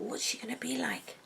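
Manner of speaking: breathy secret whisper, almost no voice; hushed whisper. Acted. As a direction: whisper it like a secret you can't contain. Hushed anticipation opening new wig.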